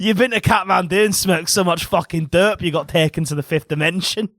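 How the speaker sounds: exaggerated comic Scottish accent; mock Scottish accent. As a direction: rant it like a caricature Scot. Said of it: "I can't do a Scottish accent".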